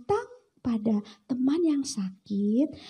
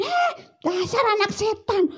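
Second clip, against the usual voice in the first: higher scolding shout; mock furious mother; angry mother; 8 semitones higher, 4 dB louder than usual. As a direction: yell at the naughty child. Mother scolding her child.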